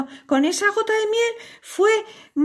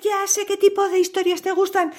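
ordinary delivery, nothing marked

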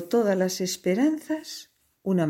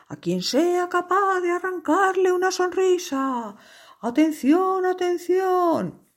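town crier; 11 semitones higher than usual. Acted. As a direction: cry it out to the whole town. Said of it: "anunciar a los cuatro vientos y con redobles de tambor".

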